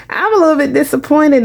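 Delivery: laughing it off; a rueful laugh through the words. Real, not acted. Laughs at own disappointment.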